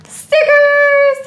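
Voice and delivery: high, loud gasp then thrilled cry; excited gasp. Real, not acted. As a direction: gasp and exclaim with glee. Finds stickers in package.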